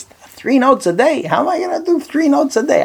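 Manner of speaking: can't believe the burden he's been given; incredulous whine. Retold or imitated. Student aghast at writing daily notes, high incredulous whine.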